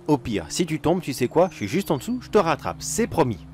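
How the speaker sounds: usual speaking voice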